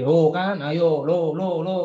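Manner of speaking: a mock told-you-so at a child who got it wrong; impatient gloating. Parent gloating at a stubborn child, lightly mocking. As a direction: tease the child a little, half in fun. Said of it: "kadang kayak gitu juga suka nggak sabar".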